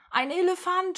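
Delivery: high, loud shout of alarm; woman yelling that danger is loose; alarmed cry. A narrator's reading. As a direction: cry out a warning to the crowd. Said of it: "schreit eine Frau".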